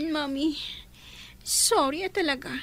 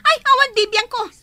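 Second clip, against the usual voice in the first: defiant shout; 2 semitones higher, 8 dB louder than usual. Acted. Girl fights in school quarrel.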